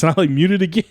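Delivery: words through a laugh. Real, not acted. Laughing as he recounts muting the call. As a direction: laugh while telling it.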